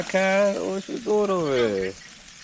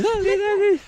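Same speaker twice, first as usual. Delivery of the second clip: dog-talk voice. Real, not acted. Checking the dog is buckled up, high baby talk. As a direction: chirp it up high for the pup.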